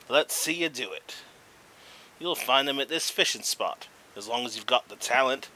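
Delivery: a lightly played Australian twang; Australian accent. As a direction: keep reading the angler's lines in a cheery Australian voice. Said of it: "I don't know why I made him Australian".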